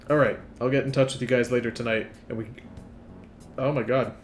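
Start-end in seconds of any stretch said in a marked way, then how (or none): 2.3-2.5 hiccuping. Real, not acted. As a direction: cut off by a hiccup. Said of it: "I'm, like, hiccuping over here".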